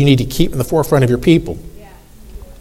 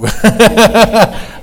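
real laugh; 5 semitones higher than usual. Real, not acted.

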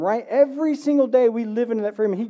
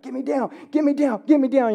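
higher, urgent imitation of an eager kid; retold excited little boy, pitched up; excited child; 4 semitones higher than usual. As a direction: imitate a kid begging to be let loose. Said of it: "they're getting excited".

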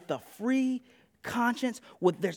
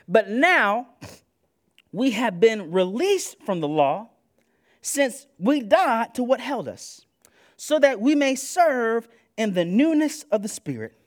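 preached scripture reading; 2 semitones higher, 5 dB louder than usual. Real, not acted.

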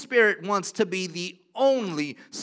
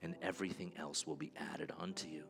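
much softer, intimate prayerful voice; hushed altar call; 12 semitones lower, 13 dB quieter than usual. Acted. Pastor quietly urging the congregation to put God first, softly. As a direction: draw them close, gently, over the music.

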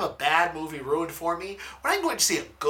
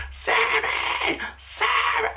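mock yelling girl; much slower than usual. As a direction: imitate a girl yelling at the screen. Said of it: "at the top of her lungs".

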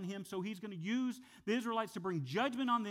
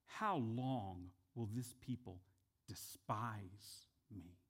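low, slow, soft and grieved; voice of God; 8 semitones lower, 7 dB quieter, slower than usual. Retold or imitated. God to Moses and Aaron, slow, low and grieved. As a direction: let God's hurt sink in slowly. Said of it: "he says this to them".

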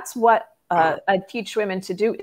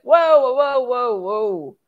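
high, loud whoas easing down to normal; alarmed backing-off; 4 semitones higher, 5 dB louder, slower than usual. Retold or imitated. Boyfriend met by overexcited girlfriend at the door, startled. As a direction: imitate someone taken aback, slowing them down.

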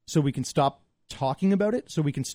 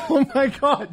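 high, loud laughing; 11 semitones higher, 5 dB louder, slower than usual. Real, not acted. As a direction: laugh out the words. Laughing at the joke.

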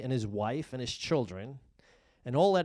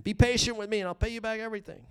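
fast, high pleading; desperate plea; 8 semitones higher, 5 dB louder, faster than usual. Acted. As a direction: beg for your life in a rush. Fellow servant begging on his knees.

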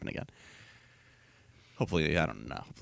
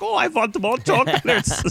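high, slow mock German accent; whiny German pig boy voice; 14 semitones higher, 4 dB louder than usual. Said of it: "he's got a German accent".

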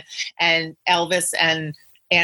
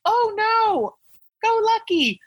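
squeaky alarmed cry, well above own pitch; high-pitched worried voice; 14 semitones higher than usual. Retold or imitated. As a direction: squeal it like a frightened fan. Quoting woman at crash.

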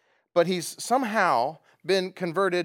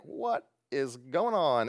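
confused Peter; much slower than usual. Retold or imitated. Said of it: "Peter's like".

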